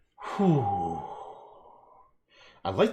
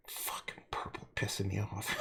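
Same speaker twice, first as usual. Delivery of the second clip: whispered frustration; 3 semitones lower, 9 dB quieter than usual. Pretend. Mock frustration.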